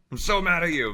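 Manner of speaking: high-pitched mock anger at a pet; hammed-up telling-off; mock scolding. Retold scolding of the dog.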